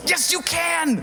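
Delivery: high mock shout; exasperated wife. Wife contradicting husband about overdosing, high exasperated shout. Wife snaps back, retold.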